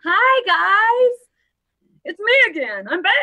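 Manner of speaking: high, loud, bubbly hello; excited greeting. Real, not acted. Host greeting her viewers as the stream opens, bubbly and high.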